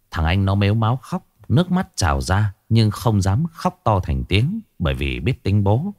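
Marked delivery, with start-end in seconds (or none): none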